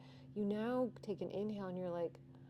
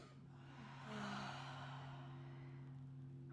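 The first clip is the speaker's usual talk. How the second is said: long, soft, audible exhaled sigh; 11 dB quieter than usual. Shown as a demonstration. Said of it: "just drop a sigh out".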